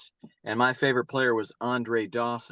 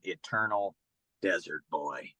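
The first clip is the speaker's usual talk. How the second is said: hushed reveal; 6 semitones lower, 6 dB quieter than usual. Real, not acted. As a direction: let the favorite name fall quietly, like a secret. Final reveal of favorite nickname.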